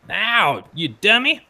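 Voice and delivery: high, pained goblin yelp; squeaky goblin cry. Acted. Goblin stabbed by its ally.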